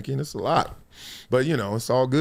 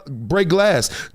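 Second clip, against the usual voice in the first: normal talking voice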